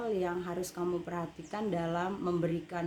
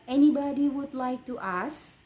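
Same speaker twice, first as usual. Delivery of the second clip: higher, louder question with "ask" lifting at the end; questioning intonation; 6 semitones higher, 5 dB louder than usual. Shown as a demonstration. Teacher models a question for students to practise, questioning intonation. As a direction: rise on "ask" as a real question. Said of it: "Intonasi ber- bertanya berbeda dengan intonasi".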